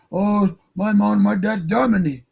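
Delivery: lightly put-on German accent; drawn-out mock German accent at the start. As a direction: imitate someone claiming German roots, in a German accent. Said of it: "German accent".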